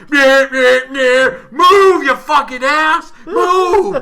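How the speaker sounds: high, loud frustrated yelling; impatient shouting. Retold or imitated. Stuck in the drive-thru line, yelling at the car ahead. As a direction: yell at the car ahead to move.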